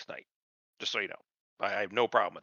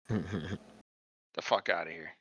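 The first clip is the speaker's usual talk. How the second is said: scoffing dismissal; 8 semitones lower, 9 dB quieter, much slower than usual. Real, not acted.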